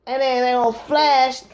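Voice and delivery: normal talking voice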